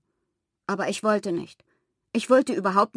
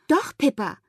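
put-on higher-pitched, insistent child's retort; bossy insistence; 5 semitones higher than usual. A narrator's reading. Pippa insisting to her friend over pajamas, bossy.

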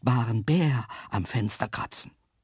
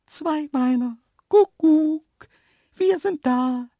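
very high, loud, singsong animal voice; antelope calling out; 8 dB louder, slower than usual. Acted. Antelopes calling at the terrace door, high-pitched hail. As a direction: call from outside in a high cartoon voice.

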